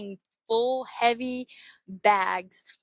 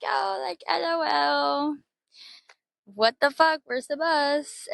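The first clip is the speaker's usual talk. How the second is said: high, slow mock-panicked whine; 7 semitones higher, slower than usual. Retelling missing the bus to a friend, high mock panic.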